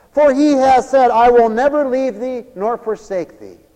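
loud, high preaching of scripture; preaching at full force. Acted. Preacher reading scripture to the church, loud fervent preaching. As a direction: proclaim the verses to the church.

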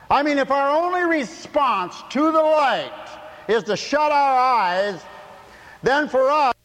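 high, loud fiery preaching; shouted preaching. Acted. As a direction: thunder it from the pulpit. Preaching at full force.

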